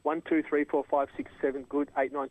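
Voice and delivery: normal talking voice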